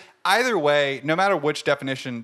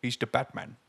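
softer, lightly put-on impression; hint of the actor, quieter; Michael Caine; 2 semitones lower, 7 dB quieter than usual. Co-host showing off catchphrase, light impersonation. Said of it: "I can say the Batman, too".

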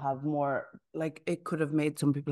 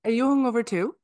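raised, louder question; louder repeat; 7 semitones higher, 6 dB louder than usual. Real, not acted. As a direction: say it again, louder and higher. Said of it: "repeated louder, higher".

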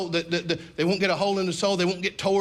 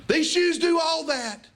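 put-on pleading child; 9 semitones higher than usual. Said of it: "pretend like".

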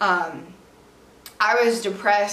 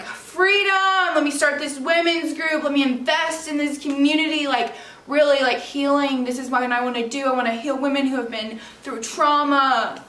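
high, excited burst easing into eager planning; elated; 5 semitones higher than usual. Retold or imitated. New graduate announcing her big plans, excited.

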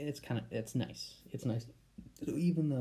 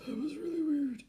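high squeak. Real, not acted. Embarrassed after saying Daddy.

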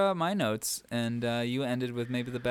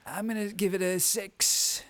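higher, slow put-on Trump voice; Trump impression; 8 semitones higher, slower than usual. Co-host mocking his partner's rating, hammed-up Trump voice. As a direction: quote him back doing Trump. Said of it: "You sounded very Trumpy when you said".

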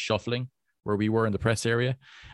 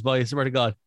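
ordinary delivery

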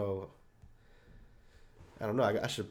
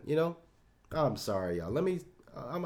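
ordinary talk

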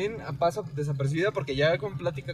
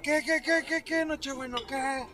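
put-on high, slurred drunk uncle calling out; 9 semitones higher than usual. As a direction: mimic a tipsy uncle shouting a greeting. Drunk uncle greeting narrator.

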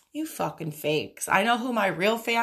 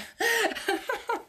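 laughing. Real, not acted. Laughing at her own hype.

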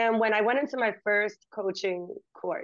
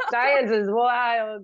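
slow, laughing through it; 7 dB louder, much slower than usual. Real, not acted. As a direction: laugh the words out. Laughing while saying it.

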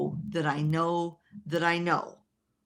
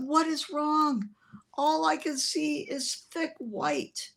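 higher, slower voice of a bewildered man; dazed pastor; 9 semitones higher, slower than usual. Retold or imitated. Weeping pastor who cannot see asking what is wrong, higher and slow. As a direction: sound lost and overwhelmed, drawn out.

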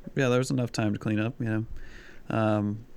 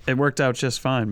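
ordinary talk

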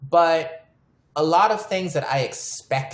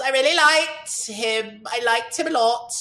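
lightly put-on English accent, higher; 8 semitones higher than usual. Telling friends about her crush in a playful English accent. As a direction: swoon over him in a mock English accent. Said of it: "And the English accent is back".

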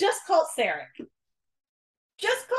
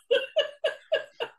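laugh; 9 semitones higher, 4 dB quieter than usual. Real, not acted. Giggling about T'Pring's backup.

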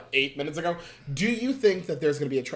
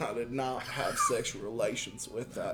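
slow, soft lightly voiced Clinton; molasses Clinton; 6 semitones lower, 9 dB quieter than usual. Shown as a demonstration. Host redoes Clinton for his friend, slow molasses drawl. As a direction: drag every word like molasses in the mouth. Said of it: "talk like you have a bunch of molasses in your mouth".

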